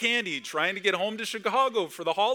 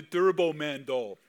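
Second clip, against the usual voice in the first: mock Austrian action-hero accent; Arnold Schwarzenegger accent; slower than usual. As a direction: lightly put on an Austrian accent. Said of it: "Is that a good accent".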